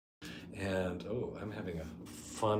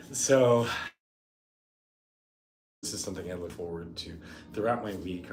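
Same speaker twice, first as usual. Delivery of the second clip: ordinary talk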